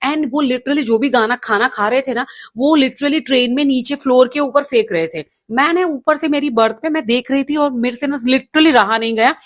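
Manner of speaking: normal talking voice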